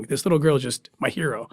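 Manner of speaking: usual speaking voice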